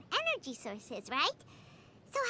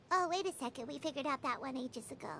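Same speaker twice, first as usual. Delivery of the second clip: deflated realisation; 8 semitones lower, 7 dB quieter than usual. Acted. Realising they figured that out ages ago, deflated. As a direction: drop low and soft after the outburst. Realises twist is old news.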